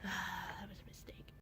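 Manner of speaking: soft unvoiced whisper; whispered regret. Real, not acted. Admitting a past mistake in a soft whisper. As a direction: breathe it out as regret.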